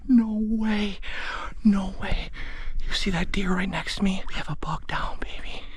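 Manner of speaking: can't believe it, whispered with joy; giddy hunter whispering; excited disbelief. Real, not acted. Disbelief after the shot.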